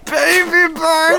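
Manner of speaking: put-on very high, drawn-out baby bird chirp. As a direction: stretch it out like a hungry chick. Feeding mushroom, mouth open.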